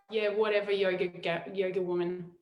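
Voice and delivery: low, quiet, dismissive grumble; softly re-enacted frustration; retold frustration. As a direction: brush someone off, understated and fed up. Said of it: "I was so frustrated".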